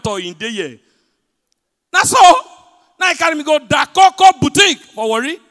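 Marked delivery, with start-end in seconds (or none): 1.9-5.4 much higher, loud storytelling burst; excited shout. Real, not acted. Comedian telling the crowd about his lucky day, excited shout. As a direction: blurt it out thrilled. Excited retelling at full volume.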